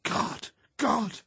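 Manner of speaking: loud, high exclamations of terror; shuddering-horror outburst; horrified cry. A narrator's reading. Horrified cries on discovering truth.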